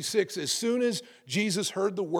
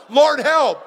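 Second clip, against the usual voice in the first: loud, higher cry of Lord, help; short cry for help; 8 semitones higher, 13 dB louder than usual. Shown as a demonstration. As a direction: cry it out urgently. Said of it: "let's practice that one".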